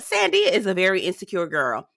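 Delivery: ordinary talk, nothing marked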